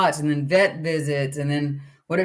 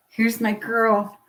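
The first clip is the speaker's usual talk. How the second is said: tender greeting; 4 semitones higher, slower than usual. Real, not acted. Greeting puppy.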